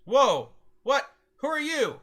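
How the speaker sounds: high, loud startled outburst; a guard caught off guard, shouting in surprise. Acted. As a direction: jump back with a shocked yell. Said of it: "he says when he sees".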